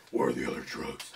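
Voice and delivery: very low, hushed, rasping vigilante; low superhero interrogation voice; Batman. Acted. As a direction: growl it deep and quiet.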